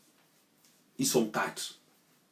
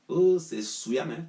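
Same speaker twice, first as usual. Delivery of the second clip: slow, higher scornful oh, c'est souillant; scornful drawl; 6 semitones higher, slower than usual. Acted. Scolding party rivals, a slow disgusted drawl. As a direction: stretch it out in disgust.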